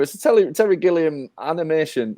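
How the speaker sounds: ordinary talk, nothing marked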